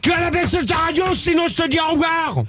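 high-pitched, exasperated threat; angry outburst. Real, not acted. Host threatening to hang up on a caller, angry outburst. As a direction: scold him at a high pitch, fed up. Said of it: "Mais calme-toi".

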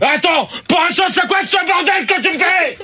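very high, exasperated yelling; real furious outburst. Real, not acted. Host protests at the mess made of his show, furious outburst. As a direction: explode at the mess, voice shooting up. Host explodes at prank names.

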